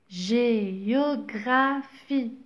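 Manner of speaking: géographie drawn out, syllable by syllable; slow model reading. Shown as a demonstration. Teacher to pupils, reading géographie very slowly. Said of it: "Le E est toujours muet".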